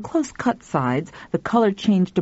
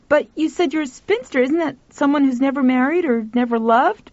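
put-on high-pitched, puzzled boy's question; young boy; 8 semitones higher than usual. A narrator's reading. Boy questions the spinster about living with Peter, high young voice. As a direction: ask high and innocently, like a boy.

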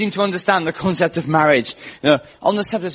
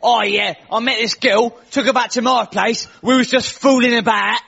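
higher-pitched put-on Essex lad; Essex accent; 5 semitones higher than usual. Essex man explaining himself on a talk show, Essex accent. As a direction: play a TV guest in mock Essex. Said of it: "there was this guy on from Essex".

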